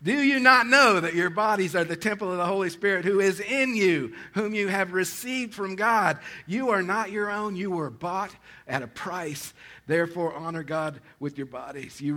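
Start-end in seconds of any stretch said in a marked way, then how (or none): 0.0-9.5 loud, higher fervent sermon voice; preaching at full force. Acted. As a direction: drive the point home to the church.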